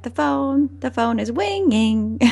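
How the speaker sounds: "winging" with W in place of R; imitated speech impediment. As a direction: mimic the cartoon duck's lisp on "winging". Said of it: "have a speech impediment".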